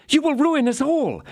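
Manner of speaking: high alarmed cry; frightened cry. A narrator's reading. Wife stops D'Artagnan rushing out, high frightened cry. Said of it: "cried Madame Bonancieux".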